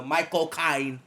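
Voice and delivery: slow mock Cockney, pitched a bit up; Michael Caine. Shown as a demonstration. Showing friends his Cockney pick, slow Cockney accent. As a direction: draw it out in a put-on Cockney accent. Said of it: "Or the Cockney, like, uh".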